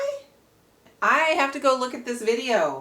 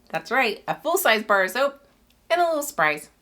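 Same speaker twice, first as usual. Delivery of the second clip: normal talking voice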